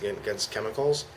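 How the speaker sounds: ordinary talk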